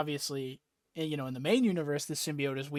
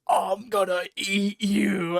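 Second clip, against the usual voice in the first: slow, louder, higher monster growl of a threat; 4 semitones higher, 6 dB louder, much slower than usual. Acted. Venom-possessed Peter threatening a mugger, slow monstrous threat. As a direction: drag out each word like a hungry monster.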